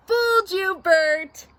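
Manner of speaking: high, gleeful prankster voice; Ernie. A narrator's reading. Ernie crows over his prank on Bert, high and loud. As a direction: call out the gotcha in a high voice. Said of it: "said Ernie".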